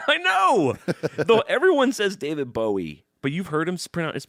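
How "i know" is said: high, loud laughing shout; shrieked agreement through a laugh; exasperated yell. Real, not acted. Laughing exclamation in heated debate.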